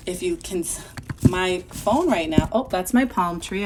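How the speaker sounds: plain reading voice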